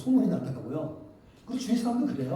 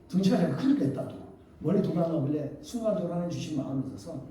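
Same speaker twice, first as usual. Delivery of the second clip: ordinary talk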